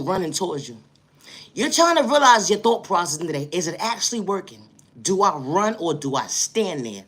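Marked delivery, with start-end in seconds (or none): none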